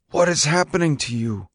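understated anger pushing through a father's concern. A narrator's reading. Father confronting his troubled son, concerned and mildly angry. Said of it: "The concern again, and now anger".

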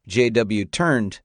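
usual speaking voice

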